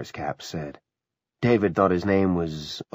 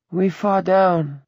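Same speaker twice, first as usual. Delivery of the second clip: put-on high, slow child's voice; little child; 12 semitones higher, 5 dB louder, slower than usual. Pammy finishing the conductor's account, childlike. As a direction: chime in slowly, like a small child.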